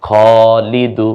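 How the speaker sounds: Shahida stretched syllable by syllable, each vowel held long; slow drawn-out reading. Shown as a demonstration. Teacher to learners, showing the joined word, very slow. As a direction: draw out Shahida slowly and clearly. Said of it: "পড়াটা খুব সহজ".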